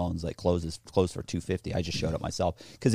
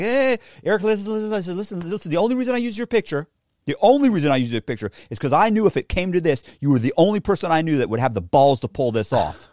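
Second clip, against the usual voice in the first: high, loud, fast placating; frantic pleading; 8 semitones higher, 5 dB louder than usual. Retold or imitated. Man calming an angry tough guy, frantic. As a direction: talk him down in a panic.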